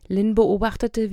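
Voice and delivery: ordinary delivery, nothing marked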